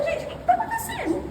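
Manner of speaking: very high-pitched, baffled question; bewildered plea. Retold or imitated. Retold bewildered thought about quarrels. (perplexidade em voz aguda)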